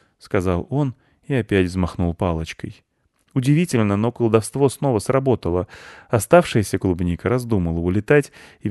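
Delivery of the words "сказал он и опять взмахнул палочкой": plain reading voice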